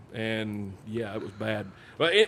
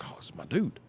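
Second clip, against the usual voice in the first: whispered aside; 10 dB quieter than usual. Retold or imitated. Retold aside to himself.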